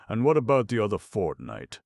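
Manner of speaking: loud, sharp demand; a gruff thief loudly demanding answers. A narrator's reading. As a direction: throw the question at him hard. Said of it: "demanded Sykes".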